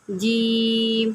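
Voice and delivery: جیم stretched out very slowly; جیم with a long held ee, three alifs long; long drawn vowel. Shown as a demonstration. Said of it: "تین fingers open کی ہیں".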